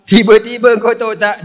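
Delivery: ordinary talk, nothing marked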